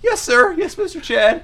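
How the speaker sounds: very high, servile squeak; obey in a tiny submissive voice; meek underling. Acted. Diner answering bully Chad by phone, squeaky and submissive.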